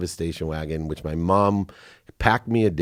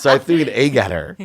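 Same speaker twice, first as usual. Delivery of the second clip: loud, high, laughing punchline; 8 semitones higher, 9 dB louder than usual. Real, not acted. Speaker confessing his boyhood revenge, laughing loudly. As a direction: land the punchline through laughter.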